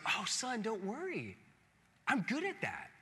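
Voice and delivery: calm the worried son; reassuring father. Retold or imitated. Father reassures his distraught son about a book report, softly.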